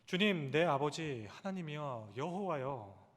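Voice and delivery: slowly calling each name of God; slow crying out. Shown as a demonstration. Pastor models for the flock how to cry out, slow and earnest. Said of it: "외칠 수 있기를 바랍니다".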